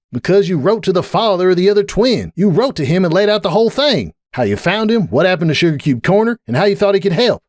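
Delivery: high accusing character voice; Applejack, accusing. A narrator's reading. Applejack exposing Sunburst's letter, high and accusing.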